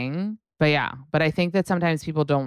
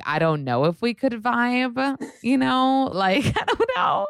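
skeptical; 10 semitones higher than usual. Retold or imitated. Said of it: "that's when I'm like".

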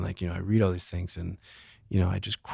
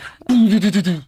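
high, loud stuttering mock wail; hammed-up burst of juddering sobs; imitated crying outburst; 12 semitones higher, 10 dB louder than usual. Shown as a demonstration. Speaker showing he never cries just one tear, mock wailing. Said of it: "It's always like".

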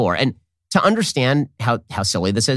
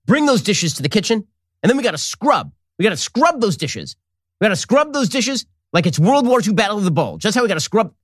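high, pumped-up battle-cry voice over chores; rally the family like a general about the dishes; hammed-up general ordering dish duty; mock Bannon war talk; 6 semitones higher than usual. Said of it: "I'll bet he talks this way".

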